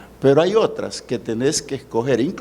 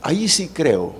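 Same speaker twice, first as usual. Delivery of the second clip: ordinary talk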